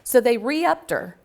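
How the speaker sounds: normal talking voice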